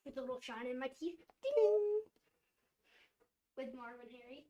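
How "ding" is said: high, ringing ding; playful sound effect; imitated sparkle ding. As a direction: sing out a little tooth-glint chime.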